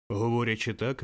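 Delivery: ordinary delivery, nothing marked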